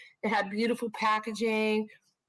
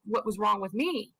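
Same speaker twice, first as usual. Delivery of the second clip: ordinary delivery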